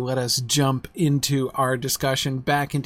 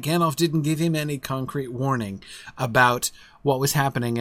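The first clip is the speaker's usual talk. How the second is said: usual speaking voice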